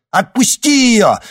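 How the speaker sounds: loud, high, slow command; shout. A narrator's reading. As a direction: yell it out, drawing it out. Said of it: "крикнул Никита".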